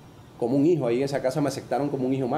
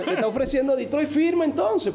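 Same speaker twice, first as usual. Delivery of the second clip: urging father; 12 semitones higher than usual. Retold or imitated. Imitating dad urging him.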